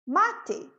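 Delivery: Mate said as Portuguese "ma-te" with two syllables. Shown as a demonstration. Teacher shows the Portuguese word mate, two clear syllables. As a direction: say "Mate" the Brazilian way, final e sounded. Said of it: "É, em inglês, a gente usa ela do nosso jeito".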